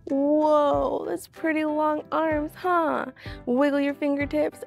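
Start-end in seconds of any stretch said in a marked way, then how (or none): none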